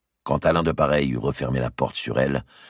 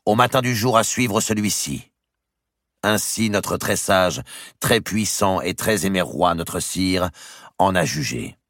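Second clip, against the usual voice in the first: formal sentence reading; 7 semitones higher, 3 dB louder than usual. Acted. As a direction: read out a royal judgement, each phrase held up.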